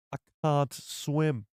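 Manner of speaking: "I can't swim" drawn out slowly, each word stretched; slow imitation. Shown as a demonstration. Streamer repeats Kino Loy line slowly for chat, drawn-out imitation. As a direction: savour the line slowly like the actor did. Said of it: "He's got that can't, you know?".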